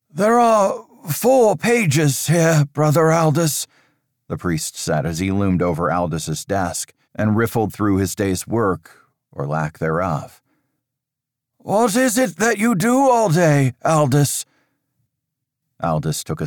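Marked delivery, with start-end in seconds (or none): 0.1-3.7 a higher, louder stern priest's voice, measured and grave; stern old priest. Acted. Father Riker warning Aldous of dark times, stern and raised. 11.6-14.4 a higher, louder stern priest's voice, measured and grave; stern old priest. Acted. Father Riker warning Aldous of dark times, stern and raised.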